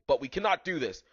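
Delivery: plain reading voice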